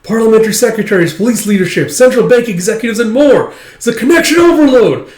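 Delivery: high, loud, frantic overexcited rush; freaking out. Retold or imitated. Loid to himself, freaking out over new contacts, frantic and high. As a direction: gush over all the contacts in a frenzy. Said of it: "Laurie's just freaking out".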